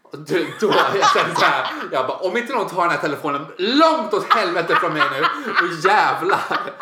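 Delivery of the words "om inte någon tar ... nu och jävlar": loud, higher-pitched acted outburst; hammed-up exasperation; burning with irritation. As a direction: rant as if about to explode. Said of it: "det bara brann i mig".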